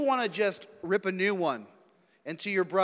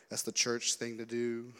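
slow, low and soft drone; dully reciting a church routine; mock rote indifference; 5 semitones lower, 5 dB quieter than usual. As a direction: drag it out like going through the motions. Voicing a rote attitude to communion.

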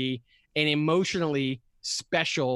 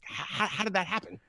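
soft, higher, stammering disbelief; mock bewildered little voice; imitated bewilderment; 5 semitones higher, 5 dB quieter than usual. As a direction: stammer it quietly like a stunned kid. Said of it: "he was bewildered".

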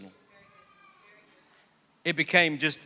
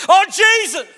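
much higher and louder cry to Jesus; loud prayer cry; 13 semitones higher, 10 dB louder, slower than usual. Retold or imitated. Youth group kids crying out to Jesus over a broken leg, loud. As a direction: shout it out like a fervent teen.

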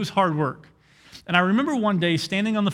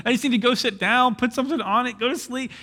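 mock sufferer; 8 semitones higher than usual. Imitating a chafed, limping person.

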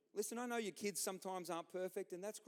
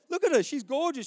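much louder and higher, gushing pride; proud dad; 10 semitones higher, 14 dB louder than usual. Acted. Dad showing off his daughter to the crowd, loud and gushing. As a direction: boast about her to the whole room.

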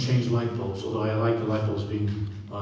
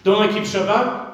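higher, louder, pleading protest; protesting worshipper; 8 semitones higher, 5 dB louder than usual. Retold or imitated. Worshipper insisting to Hashem, higher and louder. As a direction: plead your record before God. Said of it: "then you say to Hashem".

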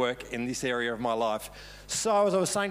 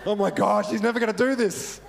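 raised, louder mock panic; freaking out; 7 semitones higher, 7 dB louder than usual. Pastor fretting over an unfinished sermon, freaking out. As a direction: fret out loud, pitched up and loud. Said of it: "Pastor B D is freaking out".

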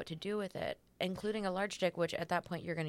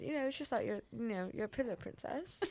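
higher-pitched mock British accent; imitated British accent; 5 semitones higher, 5 dB quieter than usual. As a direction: do a lightly put-on British man's accent. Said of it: "That's my British accent".

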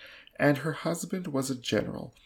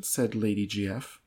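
plain reading voice

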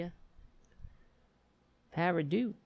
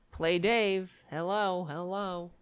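ordinary talk

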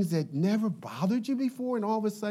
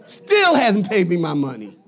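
loud mock grumble; hammed-up resentful complaint; secret resentment; 9 dB louder than usual. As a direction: repeat the grudge loudly for the crowd. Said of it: "secretly feeling resentment towards people".

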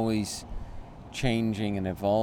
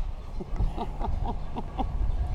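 breathy laugh; 12 semitones higher than usual. Real, not acted. Laughing at own lost wallet.

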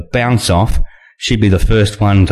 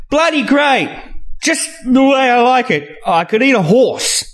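much higher, brash and greedy; Maxine gloating. Acted. Maxine answering Julianne through a mouthful, much higher and brash. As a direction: boom out your delight in the steak.

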